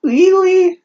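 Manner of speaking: high, hammed-up girly gasp; mock Reichu voice. Reader voicing Reichu's delighted question to Denmark, high mocking squeal. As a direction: squeal it like a starstruck fangirl.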